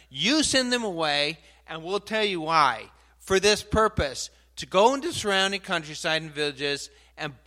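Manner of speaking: loud, insistent order to Jesus; bold demand. Acted. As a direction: tell Jesus what to do, forcefully. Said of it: "I think that's bold".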